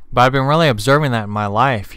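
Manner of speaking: ordinary talk, nothing marked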